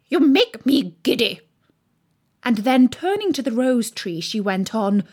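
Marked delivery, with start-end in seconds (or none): 0.1-1.4 loud, raised imperious voice; screamed. A narrator's reading. Queen scolds bowing gardeners, in a loud scream. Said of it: "screamed the Queen".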